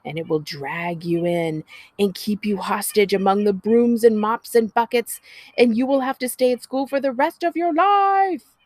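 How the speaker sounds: big sister scaring Tally about a school monster; menacing monster story. A narrator's reading. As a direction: ham up the spooky threat. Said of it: "as menacingly as she possibly can".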